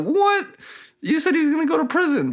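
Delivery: very high, squeaky disbelief rushing into protest; mock outraged shock. Ahan protests the killing to Telri, mock outraged shock. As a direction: blurt it way up high, imitating someone appalled.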